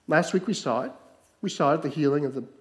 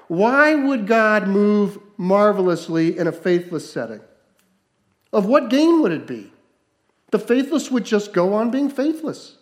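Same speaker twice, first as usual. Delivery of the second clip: loud, higher, impassioned sermon; preaching at full force; 6 semitones higher, 7 dB louder than usual. Acted. Pastor challenging the congregation's faith, impassioned preaching. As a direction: press the point hard on the crowd.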